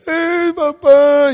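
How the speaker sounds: put-on high, loud, very slow wailing child's call; imitating a crying daughter. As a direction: wail for papa like an upset little girl. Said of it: "empieza llorando".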